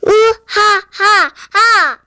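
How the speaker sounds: slow, booming evil laugh; booming giant laugh. Acted. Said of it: "큰 소리로 말하는 거예요".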